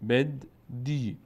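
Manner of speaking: بد then دی with long ای, said slowly; teacher sounding out بد دی; slow syllable drill. Shown as a demonstration. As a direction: split بد، دی with the ی stretched.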